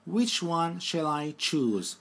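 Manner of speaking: slow, deliberate question; careful teacher pace; slow dictation. Shown as a demonstration. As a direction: read it slowly for learners. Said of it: "Bakın birinci hali".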